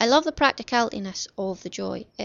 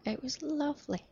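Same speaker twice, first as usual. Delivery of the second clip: higher, softer, smiling voice; 5 semitones higher, 7 dB quieter than usual. Real, not acted. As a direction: let the smile come through. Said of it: "can you hear me smiling when I say that".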